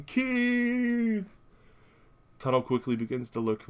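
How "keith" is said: high, long-held calling out; imitated yell. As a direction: stretch the name out on one high note.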